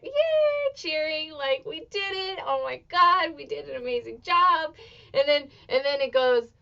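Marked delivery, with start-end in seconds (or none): 0.0-3.4 a high squealed yay; high-pitched retold cheer; mock cheer. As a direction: cheer it like the gals backstage. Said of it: "all the gals are just like".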